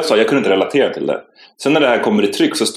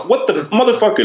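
much higher, stunned exclamation; outraged disbelief; 11 semitones higher, faster than usual. Retold or imitated. Reacting to the twisted newspaper story, high and stunned. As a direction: explode in disbelief.

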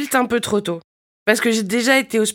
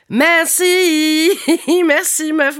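delighted thanks; 9 semitones higher, 4 dB louder, much slower than usual. Acted. Flattered reply to compliments.

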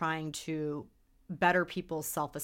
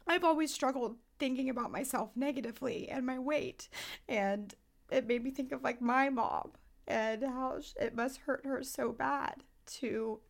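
higher and softer, voice close to breaking; getting emotional; 6 semitones higher, 5 dB quieter than usual. Real, not acted. Host tells co-host how the ad moves her, higher and softer, close to tears. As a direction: hold back tears as you speak. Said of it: "I get emotional".

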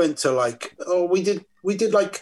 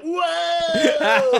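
excited crowd cheer. Retold or imitated. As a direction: whoop it up like thrilled friends. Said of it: "all my mates were like".

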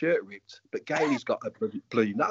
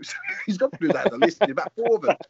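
laughing; 8 semitones higher, 5 dB louder, faster than usual. Real, not acted. Laughing while recounting the brawl.

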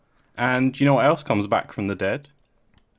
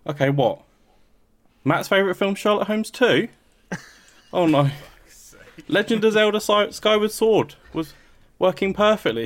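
mock phone call; 9 semitones higher than usual. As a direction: fake shocked caller on phone. Fake phone call, acting shocked.

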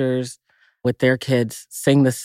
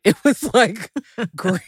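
laughing; 9 semitones higher than usual. Real, not acted. Laughing at the green thermos.

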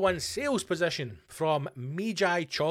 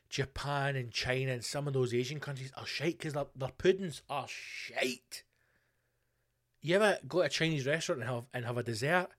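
ordinary delivery

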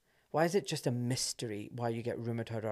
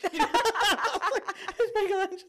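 high, loud question broken by laughter; laughing; 7 dB louder, much slower than usual. Real, not acted. Still laughing about the Michelangelo surgeon, laughing. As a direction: repeat it while cracking up.

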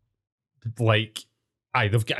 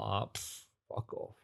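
much softer, under-the-breath brush-off; muttered dismissal; 3 semitones lower, 15 dB quieter than usual. Retold or imitated. Bored pupil in assembly, muttering much softer. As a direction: grumble it away to yourself. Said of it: "I was sitting there like".

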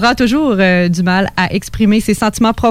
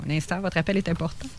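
quick, quiet phone hold message; customer service voice; 2 semitones lower, 13 dB quieter, faster than usual. Pretend. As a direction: rattle it off like a hold recording.

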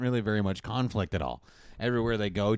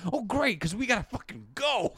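high, frantic hurry-up; mock urgency; 13 semitones higher than usual. Host as Santa hurrying the heroine, high comic urgency. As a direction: push them out the door, high-pitched.

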